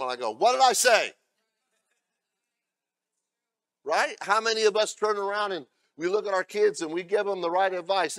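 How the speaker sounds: loud, ringing pulpit delivery; full-force preaching. Acted. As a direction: preach it out hard to the congregation.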